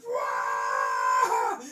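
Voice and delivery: long, loud mock wail; big drawn-out shriek; frightened scream. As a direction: scream "wah" in cartoon panic.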